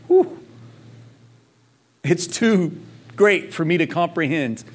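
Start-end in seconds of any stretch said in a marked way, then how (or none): 0.1-0.4 high, sharp breath of amazement; awed high whew. Real, not acted. Pastor stunned by the vastness of God's thoughts, high awed whew. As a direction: let out an overwhelmed whew.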